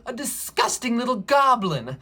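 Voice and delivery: high, loud storybook revulsion; hammed-up disgust, punching the words; disgusted innkeeper. A narrator's reading. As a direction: spit it out as an appalled innkeeper. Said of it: "said the innkeeper".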